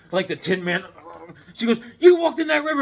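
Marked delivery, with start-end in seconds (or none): 2.0-2.8 high, loud accusing mom; mock scolding. Mom catches son after the river, mock scolding. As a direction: scold like a mother who just found out.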